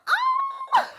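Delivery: very high squealing cackle; shrieking laugh. Acted. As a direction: let out a manic giggling shriek. Miss Vocab cackles.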